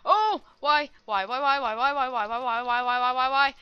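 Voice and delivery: rapid-fire, loud repeated whys; loud, frantic string of whys; loud dismayed protest. Real, not acted. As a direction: wail why over and over. Dies right after boasting.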